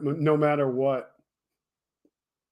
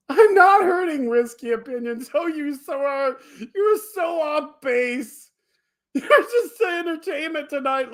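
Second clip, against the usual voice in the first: high, giggly voice through laughter; squeaky laugh-talk, slowed; 9 semitones higher than usual. Real, not acted. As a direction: talk while still cracking up. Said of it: "You're so making me laugh".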